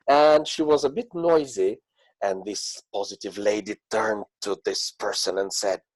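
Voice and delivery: ordinary talk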